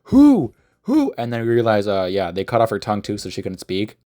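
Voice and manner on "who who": rising to very high, loud shouts; mock frantic demand. Lucius questioning wounded Lavinia, mock frantic demand. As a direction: escalate each question into a yelp.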